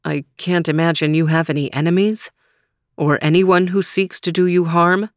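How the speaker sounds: usual speaking voice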